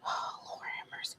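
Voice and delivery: breathy under-the-breath whisper, quick; exasperated whisper. Real, not acted. Vlogger swamped by too many games, muttered whisper. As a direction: sigh it out to yourself, barely voiced. Overwhelmed by the game backlog.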